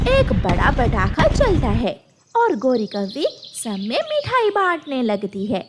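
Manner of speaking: plain reading voice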